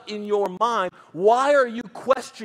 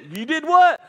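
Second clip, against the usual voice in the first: higher and louder, astonished; shocked outburst; 6 semitones higher, 6 dB louder than usual. Retold or imitated. Pastor reacting to the confession, loudly astonished. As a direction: blurt it out in shock. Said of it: "pastor asking again".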